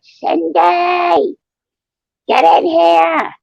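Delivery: high, slow retold yell calling a child inside; Irish mother yelling. Irish mother yelling for her daughter to come inside, a high, slow holler. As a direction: draw it out like a mom hollering down the hall. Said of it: "her mother had this really thick Irish accent".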